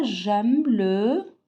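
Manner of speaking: J'aime le sport drawn out very slowly, sport falling; teacher modelling at a crawl; slow model sentence. Shown as a demonstration. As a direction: spell it out slowly for the class to repeat. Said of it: "on dit quoi?".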